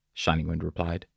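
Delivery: ordinary delivery, nothing marked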